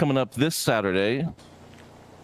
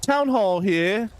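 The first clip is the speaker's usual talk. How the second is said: high-pitched mock Cockney announcer; high, exaggerated London cab driver accent; put-on British accent; 10 semitones higher than usual. Shown as a demonstration. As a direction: open a debate in a hammed-up British accent. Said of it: "when I put my British accent on".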